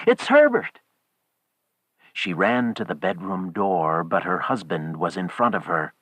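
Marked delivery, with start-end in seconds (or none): 0.1-0.8 loud, very high excited cry; screamed. A narrator's reading. Wife insists her son is at the door, screaming. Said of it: "she screamed".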